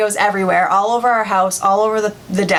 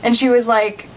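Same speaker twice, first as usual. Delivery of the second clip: ordinary delivery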